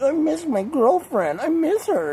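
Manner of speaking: high, drawn-out lovesick whine; mock a lovesick friend moaning in a high voice; exaggerated high whimpering complaint; mock pining.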